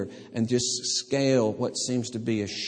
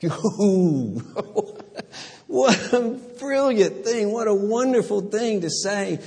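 laughing, high and delighted; 10 semitones higher, 4 dB louder than usual. Real, not acted. Preacher delighting in the woman's clever reply, laughing. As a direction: chuckle in admiration.

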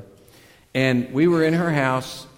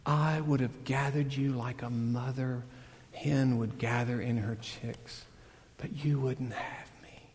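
softer, fading to a whisper at the end; quiet lament; 8 dB quieter than usual. Retold or imitated. Jesus weeping over Jerusalem, quiet sorrowful lament. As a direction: grieve it quietly under your breath. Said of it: "crying before this city".

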